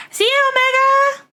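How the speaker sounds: very high, sing-song farewell; cheery goodbye. Real, not acted. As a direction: chirp a bright goodbye. Calling goodbye to chat.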